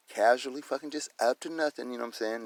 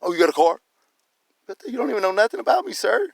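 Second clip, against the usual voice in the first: indignant challenge; 10 semitones higher, 5 dB louder than usual. Retold or imitated. Retold confrontation, challenging the officer.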